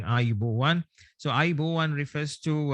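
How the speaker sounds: ordinary talk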